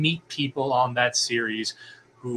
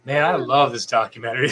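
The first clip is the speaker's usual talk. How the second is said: normal talking voice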